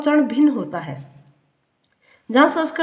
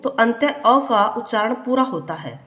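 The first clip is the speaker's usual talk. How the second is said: ordinary talk, nothing marked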